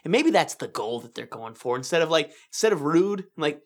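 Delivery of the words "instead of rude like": ordinary talk, nothing marked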